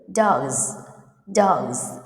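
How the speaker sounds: "dogs" with the final S said as Z; Z ending. Shown as a demonstration. Teacher shows students the differences, Z ending on "dogs". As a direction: say "dogs" low and falling, ending in Z. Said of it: "S letter pronounce Z".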